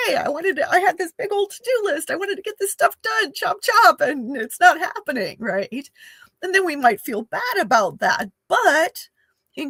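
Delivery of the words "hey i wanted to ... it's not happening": high, quick, play-acted urgency; mock go-getter eagerness. Voicing our inner push to get the to-do list done, mock eagerness. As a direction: rattle it off high like an eager task-tackler. Acts out feeling pressed to do list.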